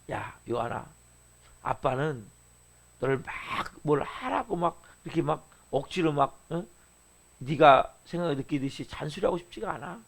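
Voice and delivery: low, soft fatherly talk; father to son. Retold or imitated. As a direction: speak gently and low to your son. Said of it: "제가 어제 밤에 아들한테 그랬어요". (아들에게 나직이 타이르는 아빠 말투)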